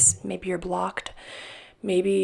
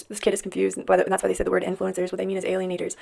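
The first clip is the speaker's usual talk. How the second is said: ordinary delivery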